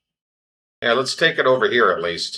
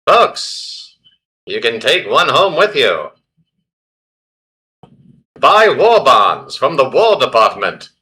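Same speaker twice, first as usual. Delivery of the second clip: lightly hammed-up 1940s announcer calling out to the crowd; a hint of a wartime radio presenter selling the game; old newsreel announcer; 3 semitones higher, 4 dB louder than usual.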